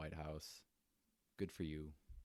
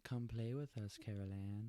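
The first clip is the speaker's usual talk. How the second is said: mock eerie child voice, lightly raised; a hammed-up spooky invitation from the twins; creepy ghost twins; 4 semitones higher than usual. Imitating the Shining twins to tease her co-host with a creepy call.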